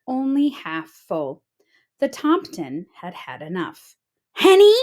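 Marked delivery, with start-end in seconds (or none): 4.3-4.7 shouted high and loud; angry shout. A narrator's reading. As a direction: yell her name in a rage. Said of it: "he shouted".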